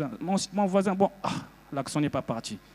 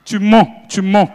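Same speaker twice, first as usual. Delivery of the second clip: mock accusation; 8 dB louder, slower than usual. Crowd heckle, comic retort.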